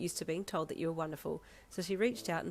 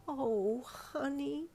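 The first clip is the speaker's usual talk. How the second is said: high, touched, tender wobble; wobbly voice; 9 semitones higher, much slower than usual. A narrator's reading. Woman moved by a broken gift, wobbly voice. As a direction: coo it as if welling up. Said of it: "she said in a wobbly voice".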